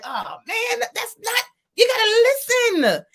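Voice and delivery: high-pitched, exasperated plea; retold protest. To sisters who won't listen to the singer, pleading protest. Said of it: "And I was like".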